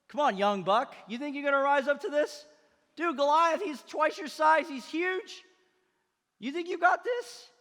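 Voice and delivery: high, loud incredulous challenge; scoffing challenge. Acted. King Saul doubting young David against Goliath, loud scoffing. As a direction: shout down an upstart in disbelief.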